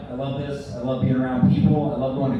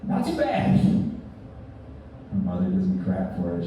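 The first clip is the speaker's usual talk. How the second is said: ordinary talk, nothing marked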